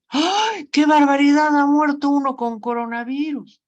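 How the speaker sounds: grito alto y lamentoso de espanto; exclamación de espanto. Shown as a demonstration. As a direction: exclama horrorizado, casi llorando. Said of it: "Fíjense la diferencia de pronunciación". (exclamación de espanto, alta y fuerte)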